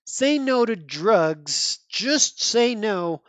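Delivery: drawn-out, slow, preachy slogan read; mock slogan. Reviewer mocking the nineties comic slogan, slow and preachy. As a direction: spell out the old anti-drug slogan, very slowly.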